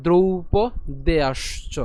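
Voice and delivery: দ্রৌপদেয়াশ্চ very slowly, part by part; stretched, separated দ্রৌপদেয়াশ্চ; careful model pronunciation. Shown as a demonstration. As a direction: draw out drou, then po, deyash-cho. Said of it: "উচ্চারণ কেমন হবে?".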